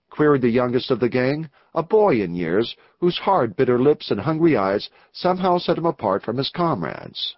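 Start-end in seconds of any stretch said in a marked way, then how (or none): none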